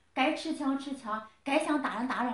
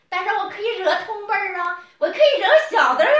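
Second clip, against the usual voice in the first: a hint of smug bully, louder, laughing; mocking his voice; 6 semitones higher, 7 dB louder than usual. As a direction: sneer as if voicing him. Mocking his attitude in first person.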